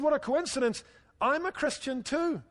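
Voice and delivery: high-pitched, eager discovery of common ground; mock delighted surprise. Rescuer to man on the bridge in a joke, mock delighted.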